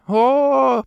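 long, high drawn-out "oh". A narrator's reading. Girl at the table spots the button, drawn-out oh. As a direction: stretch the sound out in delight. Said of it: "протянула Бриджит".

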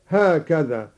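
usual speaking voice

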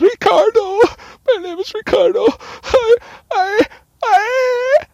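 high, loud sobbing shriek; mock hysterical screaming. Lucky gives detectives his name, mock hysterical screaming. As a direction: wail in hammed-up hysterics, breaking into stutters. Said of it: "Lucky screaming like a".

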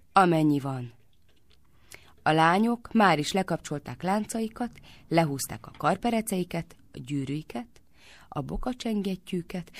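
ordinary delivery

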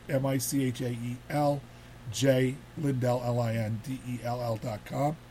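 slow, careful spelling of the address; spelled out slowly. Shown as a demonstration. Host giving viewers the website, slow and clear. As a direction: spell each letter out for listeners. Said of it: "spells out the web address".

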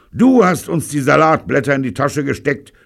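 loud, high angry yell; roaring accusation. A narrator's reading. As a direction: roar the blame at him. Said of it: "rührt Steve".

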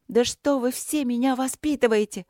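high, exasperated flare-up; angry outburst. A narrator's reading. High, angry flare-up. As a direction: princess fed up with lectures, in an angry high outburst. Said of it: "Со злостью вскинулась Каролинка".

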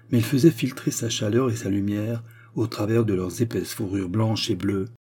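usual speaking voice

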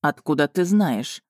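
slow, cocky character voice; cheeky smirk. A narrator's reading. Dragon challenges heroine's question about the knife, cheeky. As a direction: ask back with an insolent smirk. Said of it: "нагло усмехнулся он".

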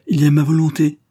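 outraged, throwing her will at him; indignant cry. A narrator's reading. Wife defying the marquis, crying out in indignation. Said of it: "s'écria-t-elle".

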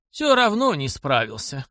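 usual speaking voice

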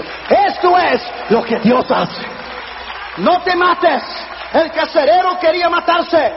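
loud, high preacher's cry; shouted, urgent sermon climax; full-force preaching. Real, not acted. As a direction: hurl it out to the congregation.

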